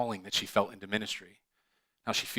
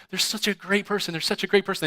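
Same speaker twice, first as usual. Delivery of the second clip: mock gushing; 10 semitones higher, 4 dB louder than usual. Gushing praise of a persona.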